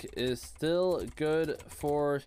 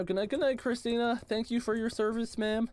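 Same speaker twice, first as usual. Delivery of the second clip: sing-song goodnight; 7 semitones higher than usual. Real, not acted. Saying goodnight to a viewer.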